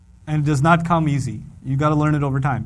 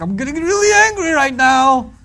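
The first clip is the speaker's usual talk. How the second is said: higher, louder mock anger; mock angry; 8 semitones higher, 6 dB louder than usual. Father playing angry at wife's teasing, higher and louder. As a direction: fake a rising temper. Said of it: "I'm like".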